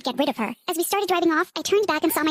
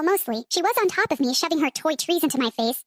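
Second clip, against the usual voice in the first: plain reading voice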